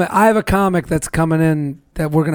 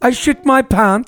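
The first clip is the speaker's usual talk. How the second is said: slow, higher fake British; mock British accent; 5 semitones higher, slower than usual. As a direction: savour it in a put-on British accent. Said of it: "It sounds nice".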